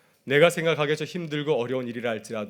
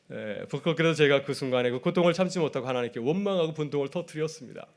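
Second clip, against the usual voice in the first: usual speaking voice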